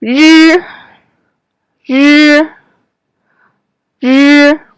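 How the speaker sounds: R with the tongue curled up and back; retroflex R. Shown as a demonstration. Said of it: "Keep your tongue curled up and back".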